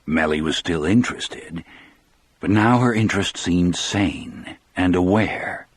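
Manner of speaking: usual speaking voice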